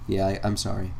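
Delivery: low, soft mock apology; sarcastic apology. Imitating Cody memeing on Jake Paul, quietly sarcastic apology. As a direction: imitate an insincere, muttered sorry. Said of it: "being sarcastic".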